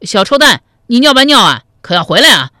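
loud call after someone leaving; loud shout. A narrator's reading. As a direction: yell after the boy, taunting. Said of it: "大声叫道".

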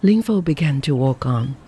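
ordinary delivery

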